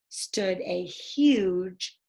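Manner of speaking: slow, dropping to a whisper; storyteller's whispered dinosaur reveal; hushed reveal. A narrator's reading. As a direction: let the last word fall away in awe.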